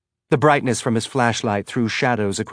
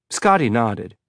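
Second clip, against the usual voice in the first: usual speaking voice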